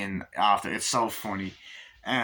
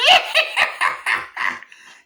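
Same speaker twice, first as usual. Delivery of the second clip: high, loud villain cackle; Chucky; 11 dB louder than usual. Retold or imitated. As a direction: cackle loudly in shrill bursts. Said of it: "he has that fucking iconic laugh".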